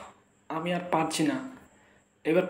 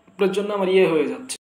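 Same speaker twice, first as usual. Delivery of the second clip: ordinary talk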